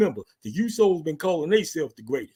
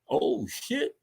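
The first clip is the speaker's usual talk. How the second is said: usual speaking voice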